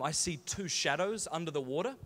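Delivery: ordinary delivery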